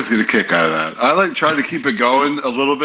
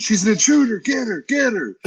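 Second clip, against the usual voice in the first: high-pitched mock alarm cry; mock scream; 10 semitones higher than usual. [name] screaming at security about the wife, mock scream. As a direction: shriek it out the truck window.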